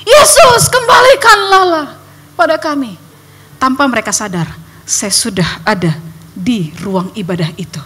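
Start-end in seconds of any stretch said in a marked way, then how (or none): 0.0-2.9 loud, high pleading shout to Jesus; crying out in prayer. Retold or imitated. Congregation praying for the missing girl, loud high pleading shout. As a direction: cry out to God for a lost child. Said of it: "semuanya sedang begini".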